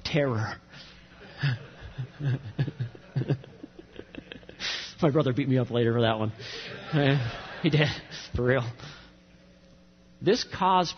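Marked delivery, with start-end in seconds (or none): 1.2-5.0 breathy silent laugh; quiet wheezing laugh. Real, not acted. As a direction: laugh it out under your breath. Laughing at own story.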